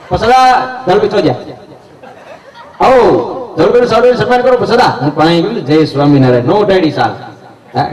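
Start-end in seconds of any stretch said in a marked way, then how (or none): 0.1-4.9 high-pitched mock MC calling out a guest; lightly hammed-up ceremony host; village announcer. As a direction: summon the guest up on stage. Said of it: "ફાડી નાખે એવી".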